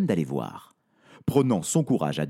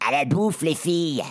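delighted goblin; 5 semitones higher than usual. Acted. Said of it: "annonce ravi le gnome".

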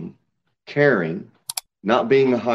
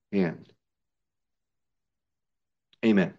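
normal talking voice

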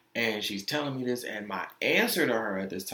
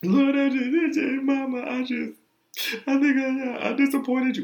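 high-pitched, loud, hammed-up wail; imitating a tearful, pleading meltdown; acted bawling; 14 semitones higher, 6 dB louder than usual. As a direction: gasp and sob the words out like a child crying to mom. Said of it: "I start bawling. I call my mom".

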